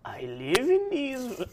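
put-on slow, high, laughing old lady. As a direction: creak it out like a frail old lady. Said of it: "let me get an old lady voice going".

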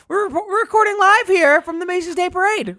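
parade reporter voice. Acted. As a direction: hype up the parade like a small-town radio host. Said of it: "take it away, Fro".

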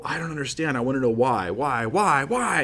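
mock pleading whys, climbing in pitch to the last; growing frustration. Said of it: "get more and more angry".

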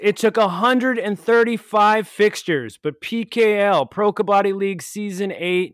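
loud, high, hammed-up grief; dramatic lament. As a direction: mourn the season end dramatically. Mock-emotional season farewell.

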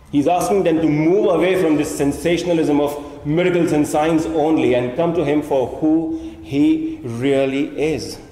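normal talking voice

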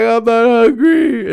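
high, slow, loud whine; whiny refusal. Retold or imitated. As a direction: whine it like a stubborn kid. Retelling reply to angry mom.

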